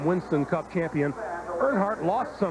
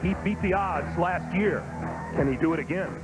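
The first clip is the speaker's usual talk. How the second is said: grand, sweeping race intro voice; booming announcer. Acted. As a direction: announce the great American race. Dramatic intro narration over music.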